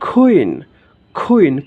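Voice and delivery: "কুইন" as khuin with a puffed k; corrected pronunciation. Shown as a demonstration. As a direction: burst of breath on "কুইন", falling. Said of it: "কুইন নয়". ("কুইন" খ-ধ্বনিতে "খুইন" উচ্চারণে)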